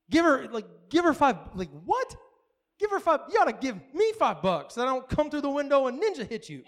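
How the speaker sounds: high disbelieving protest, pitch jumping on what; stunned what with a high yelp; incredulous outburst. Retold or imitated. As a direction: balk at the idea, voice climbing. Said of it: "what God told me to do".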